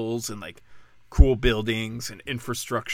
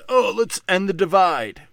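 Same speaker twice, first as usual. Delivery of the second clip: higher, louder mock-idealist quote; 6 semitones higher, 6 dB louder than usual. Commentator mocking the government's promise to end the divide, mocking. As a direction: parrot the slogan with mock enthusiasm. Mocking a politician's unity slogan.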